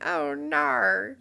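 high, loud mock "Oh, no."; mimicking his gasp. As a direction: replay his dismayed "Oh, no". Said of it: "you went".

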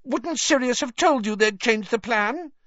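high, squeaky desperate plea; grovelling, panicky appeal; imploring squeak. A narrator's reading. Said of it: "writhing imploringly in front of him".

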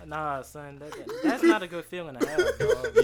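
loud high laughing complaint; loud laughing protest. Real, not acted. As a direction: say it while cracking up. Laughing loud about feeling.

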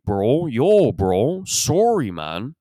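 swooping, exaggerated Toronto vowels; Toronto accent. Toronto guy apologizing to his bro, exaggerated Toronto accent. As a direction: leap up on the drawn-out vowels, Toronto-style. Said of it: "They don't raise their vowels".

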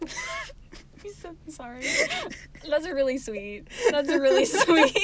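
talking through laughter; giggling out the words; laughing. Real, not acted. Laughing at friend's remark.

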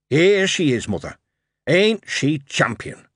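put-on boy proudly presenting his invention to his mother; happy young lad. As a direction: show off your machine like a delighted lad. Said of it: "Dick said happily".

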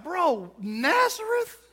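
slow, high, mocking disbelief; incredulous scoff. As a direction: drag the name out in disbelief. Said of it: "He's like".

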